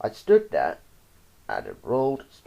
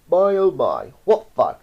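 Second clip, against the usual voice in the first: loud, raised dentist's exclamation; gleeful yell; 5 semitones higher, 7 dB louder than usual. A narrator's reading. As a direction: yell it with relish while pulling. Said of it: "pulling one by one and yelling".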